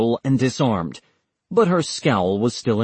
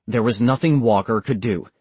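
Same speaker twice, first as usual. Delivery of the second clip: usual speaking voice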